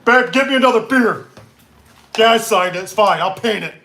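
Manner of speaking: high, loud bluster; mock gruff customer. Customer yelling to babe for beer, mock bluster. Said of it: "And they go".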